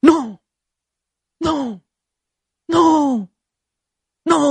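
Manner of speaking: hammed-up drawn-out refusals, spaced apart; mock a tormented film hero's protest; dramatic movie anguish. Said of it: "Hollywood gambarin gini".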